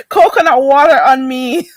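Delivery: laughing while talking; treating the viewers, still laughing; laughing through words. Real, not acted. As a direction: giggle through the offer. Jokey offer, laughing through it.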